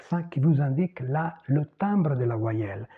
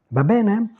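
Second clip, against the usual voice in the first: ordinary delivery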